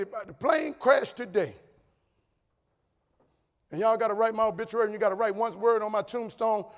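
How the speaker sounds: high, loud, building declaration; impassioned last wish. Retold or imitated. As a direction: drive it home to the whole hall. Acted last wish, climax of speech.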